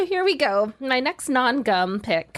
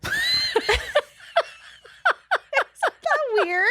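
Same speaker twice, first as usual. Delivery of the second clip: high, giggling through the words; laughing mid-sentence; 13 semitones higher, much slower than usual. Real, not acted. Laughing about mystery origin.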